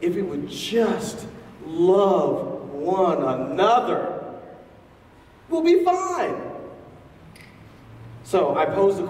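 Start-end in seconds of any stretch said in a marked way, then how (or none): 0.0-6.4 slow, rising naive plea ending much higher; imitating the lady. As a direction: play her hopeful, simple answer. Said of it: "And so she said".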